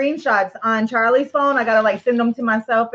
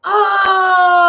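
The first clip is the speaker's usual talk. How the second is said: surprised; 6 semitones higher, 6 dB louder than usual. Real, not acted. Reacting to the pepper jar.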